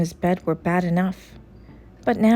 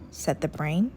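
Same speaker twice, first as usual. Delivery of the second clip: plain reading voice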